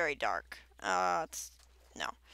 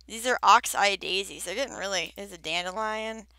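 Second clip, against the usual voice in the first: usual speaking voice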